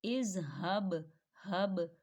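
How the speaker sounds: इज़हब then हब, with the हा thick and from the throat; slow demo of हा in इज़हब; heavy हा. Shown as a demonstration. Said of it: "हा को आपने मोटा पढ़ना है".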